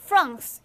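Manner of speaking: 프랑스 said as English "France", with a true f; English "France" with lip-biting f; English pronunciation. Shown as a demonstration. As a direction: say 프랑스 the English way, f and r run together. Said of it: "이렇게 발음하잖아".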